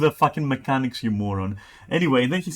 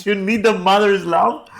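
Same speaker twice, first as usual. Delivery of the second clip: much higher and louder repeat in disbelief; mock disbelief; 7 semitones higher, 5 dB louder than usual. Real, not acted. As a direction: read it again, higher and laughing. Rereading chat message mockingly.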